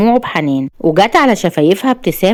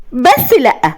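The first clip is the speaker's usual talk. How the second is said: high, deranged vengeful threat; unhinged villainess, pitch climbing with spite; gloating; 11 semitones higher, 3 dB louder than usual. A narrator's reading. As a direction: build from gloating to a crazed vow. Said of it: "وابتسمت بشماتة وقالت".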